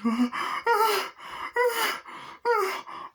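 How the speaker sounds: high, repeated gasping; hammed-up shocked breaths; horrified gasps. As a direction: imitate gasping in shock at a body. Character finds the body.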